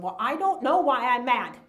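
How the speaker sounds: mock frustrated outburst, mildly done; angry. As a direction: protest crossly, punching the words. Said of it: "they're getting angry going".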